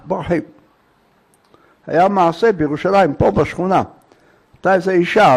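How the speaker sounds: ordinary delivery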